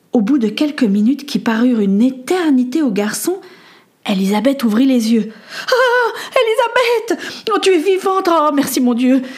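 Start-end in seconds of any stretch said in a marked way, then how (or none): 5.7-9.2 tearful relief. Acted. Boy sees his friend open her eyes, crying with relief. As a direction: sob then laugh with relief.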